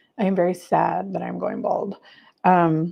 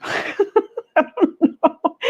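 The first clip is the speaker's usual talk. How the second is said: laughing; 10 semitones higher, much slower than usual. Real, not acted. Laughing at "good eleven thirty.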